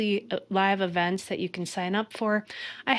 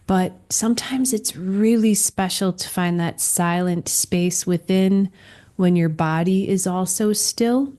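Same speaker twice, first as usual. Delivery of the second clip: normal talking voice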